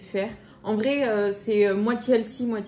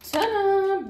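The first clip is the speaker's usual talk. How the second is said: high, loud, drawn-out ta-da; ta-da reveal; 11 semitones higher, 5 dB louder than usual. Real, not acted. Vlogger unveiling her favourite snack, high triumphant ta-da. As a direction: present it with a high fanfare.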